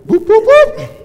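high, loud mock siren "whoop". Pastor mimicking an alarm while telling about the broken air conditioner.

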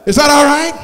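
ordinary talk

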